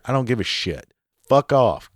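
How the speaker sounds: ordinary talk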